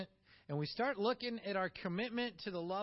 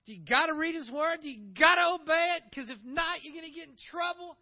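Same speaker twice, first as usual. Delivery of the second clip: mock obligation; 9 semitones higher, 11 dB louder than usual. Mocking duty-bound attitude.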